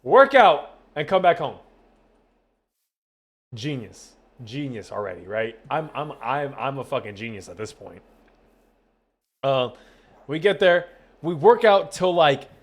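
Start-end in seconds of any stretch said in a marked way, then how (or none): 3.5-8.0 low, quiet deadpan sarcasm; sarcastic self-mockery. Real, not acted. Streamer mocking his own gym plan, dryly sarcastic.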